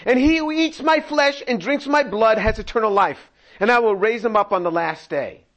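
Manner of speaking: full-voiced pulpit reading; preaching scripture. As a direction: proclaim it to the congregation. Reads Jesus' words with force.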